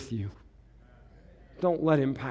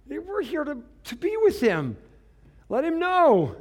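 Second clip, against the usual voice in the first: higher, eager calling to God; 12 semitones higher, 4 dB louder than usual. Acted. Pastor showing how to call out to Jesus, eager. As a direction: call out so he knows you're here. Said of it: "forget that, man".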